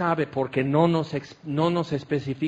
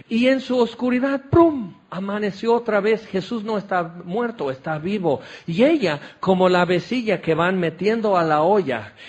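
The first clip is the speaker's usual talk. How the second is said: usual speaking voice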